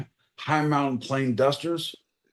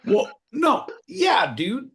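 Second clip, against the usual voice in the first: outraged yelp; 12 semitones higher, slower than usual. Real, not acted. Podcast host yelps at co-host's bass suggestion. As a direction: yelp it out, scandalized. Cries out in protest.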